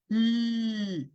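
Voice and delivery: "L" held as a long, isolated lateral sound; L sound demo. Shown as a demonstration. Teacher demonstrating the alveolar lateral, sustained. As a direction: sound out "L" alone, drawing it out. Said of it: "tenemos el sonido de la L".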